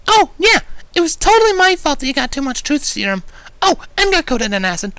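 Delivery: very high, loud bubbly pony; squeaky hyper pony rattling off her list; energetic Pinkie. A narrator's reading. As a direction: bounce through it, all energy. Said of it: "the energetic pink pony suddenly declared".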